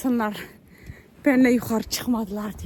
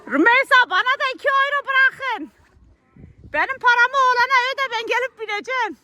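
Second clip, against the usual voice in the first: calling out; 10 semitones higher, 7 dB louder, faster than usual. Real, not acted. Calling down to family below.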